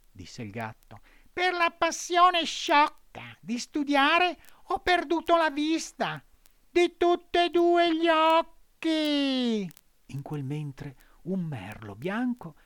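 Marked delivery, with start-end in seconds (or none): 1.3-9.7 high, slow cat voice, echoing the fox; mournful mock lament; sly cat. A narrator's reading.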